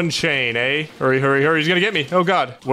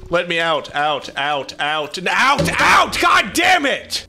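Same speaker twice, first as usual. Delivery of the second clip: frustrated shout; 3 semitones higher, slower than usual. Real, not acted. Stuck player screaming at the game, full frustration. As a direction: explode in frustration at being stuck.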